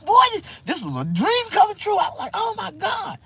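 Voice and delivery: high, squealing excitement; giddy re-enacted delight; overjoyed. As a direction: gush in loud, high-pitched disbelief. Relives his drunken delight.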